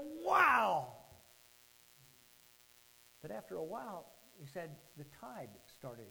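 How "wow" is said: big, high, loud exclamation; excited wow. Retold or imitated. Boy thrilled by the mud, loud acted wow. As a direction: let it burst out in acted wonder. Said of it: "This was so crazy fun".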